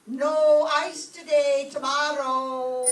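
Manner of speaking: loud, slow call to the iceman at the door; slow called-out answer to the iceman. Acted. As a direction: send it out so he hears from down the hall. Calling out to the iceman.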